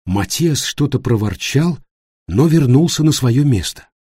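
ordinary delivery

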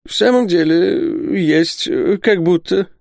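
high, slow, halting admission; hesitant. A narrator's reading. Isaac admitting he has a steelyard, hesitant. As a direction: drag it out reluctantly, with pauses. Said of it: "отвечал Исаак Хакабут нерешительно".

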